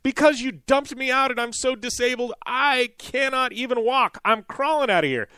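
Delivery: high, slowed mock whine; hammed-up complaint from a self-pitying woman; imitating the passenger. Passenger blaming the crew as she leaves, high whiny mock voice.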